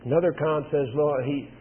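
normal talking voice

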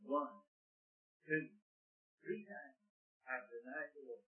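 solemn quiet sermon beat; hushed preaching. Real, not acted. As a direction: drop to a near hush for weight. Hushed counting of denials.

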